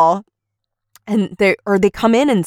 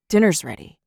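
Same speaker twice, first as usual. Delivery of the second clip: a hint of a haunted vet; 5 semitones lower, 5 dB quieter, faster than usual. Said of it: "He's like".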